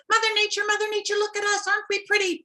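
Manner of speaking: high, eager fish voices. Acted. Fish calling to Mother Nature, high and eager. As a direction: show off, begging for praise.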